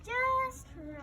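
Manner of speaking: loud delight. Acted. Goldilocks finds the right chair.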